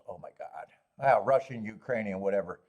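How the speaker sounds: ordinary talk, nothing marked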